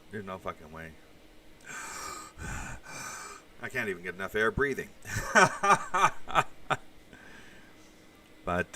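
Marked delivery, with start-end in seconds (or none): 1.5-3.4 heavy sigh. Real, not acted. Can't breathe in a mask. 4.9-7.5 a sudden high burst of laughter, falling away. Real, not acted. Laughs at own breathlessness.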